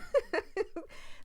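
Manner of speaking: a burst of high, airy laughter; sudden giggle; high breathy laugh. Real, not acted. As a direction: laugh out loud at yourself. Laughs at her own reaction.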